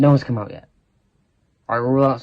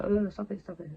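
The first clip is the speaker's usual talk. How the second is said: mock playful protest; 8 semitones higher, 10 dB quieter than usual. Play fighting in lesson.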